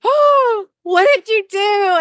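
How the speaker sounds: high, drawn-out gasp of disbelief; retold shriek of alarm; mock horrified. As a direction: wail it slowly, way up high, in comic shock. Retelling panicked second thoughts.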